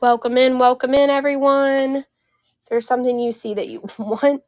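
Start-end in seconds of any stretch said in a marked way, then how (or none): 0.0-2.0 cheerful greeting. Real, not acted. Greeting viewers on live show.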